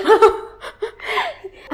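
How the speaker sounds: big laugh. Real, not acted. Laughing at the mix-up.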